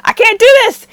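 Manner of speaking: loud, high desperate cry; desperate outburst. Retold or imitated. Tae snapping at his dad, loud desperate cry. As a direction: burst out at the breaking point. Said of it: "he's just like".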